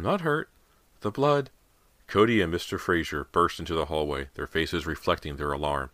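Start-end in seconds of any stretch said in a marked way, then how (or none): 0.0-1.5 shaken, high reassurance. A narrator's reading. Mother covered in blood.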